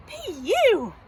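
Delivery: high, loud disgusted cub; Baby Bear. Acted. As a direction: exclaim it as if gagging. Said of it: "Baby Bear gagged".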